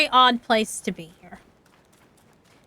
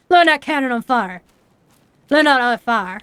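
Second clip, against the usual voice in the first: demonstrated Appalachian twang, words run together; Appalachian mountain talk, fire in one syllable; Appalachian accent. Shown as a demonstration. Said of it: "We would say".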